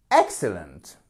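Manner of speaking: Excellent with EX hit, rest falling away; stress on first syllable. Shown as a demonstration. Teacher models the word for learners, stress on first syllable of "Excellent". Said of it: "Accentuation sur la première syllabe".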